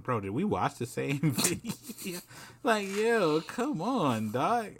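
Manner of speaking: high-pitched, incredulous protest; exasperated, amused disbelief climbing high; laughing disbelief. Real, not acted. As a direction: break into a laugh, then plead in a high voice. Mocks reactions to the verdict.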